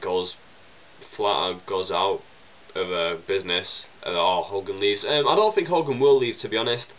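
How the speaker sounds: normal talking voice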